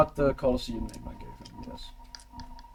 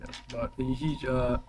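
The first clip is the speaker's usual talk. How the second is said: ordinary delivery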